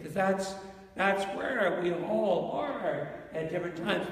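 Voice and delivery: ordinary talk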